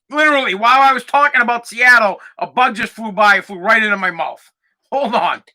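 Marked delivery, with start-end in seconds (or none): none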